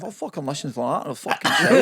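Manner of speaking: swearing in a broad Scottish accent. Shown as a demonstration. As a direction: throw in a hammed-up Scottish swear. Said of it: "I'll do it immediately on this podcast".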